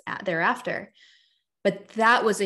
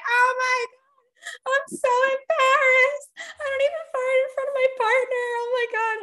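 much higher, slow mock squeal of embarrassment; embarrassed client; 4 dB louder than usual. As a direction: play the mortified client, squeaky and drawn out. Client embarrassed by farting, quoted.

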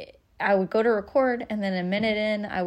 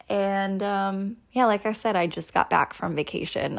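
ordinary talk

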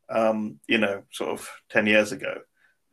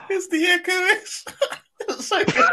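laughing. Real, not acted. Laughing at the echo bit.

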